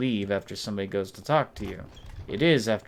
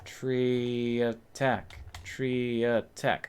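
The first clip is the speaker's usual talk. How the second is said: slow mock menace; much slower than usual. Talking to a tree in game.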